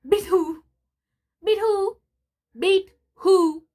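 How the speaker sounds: high, loud indignant repeat; indignant shout. Acted. Mister Spencer scoffing at Bulldog's threat, high indignant shout. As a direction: bellow it back, insulted. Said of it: "because he was indignant".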